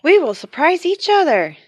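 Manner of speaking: high, loud, eager; excited announcement. Acted. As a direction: announce the plan with glee. Excited new plan.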